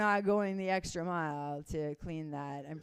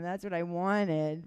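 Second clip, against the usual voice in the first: ordinary talk, nothing marked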